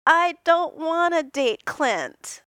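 morose.

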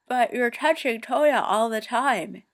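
talking through a mouthful; mouth full. A narrator's reading. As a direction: say it around a bite of burger. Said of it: "he says through a mouthful".

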